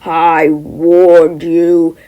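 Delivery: very slow, loud threat; menacing rage. Acted. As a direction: drag it out with menace. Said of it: "bursting with rage".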